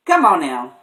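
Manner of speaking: usual speaking voice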